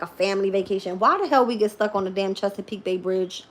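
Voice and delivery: ordinary talk, nothing marked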